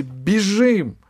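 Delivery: высокий громкий окрик; сорваться с места криком. Acted. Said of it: "и крикнула Алисе". (Ирия кричит Алисе, громко и высоко)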